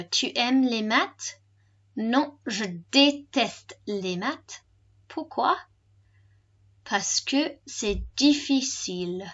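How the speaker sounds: slow, carefully spaced French. Shown as a demonstration. Teacher repeats the dialogue for learners, slowly. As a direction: say it slowly, phrase by phrase. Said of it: "And slower".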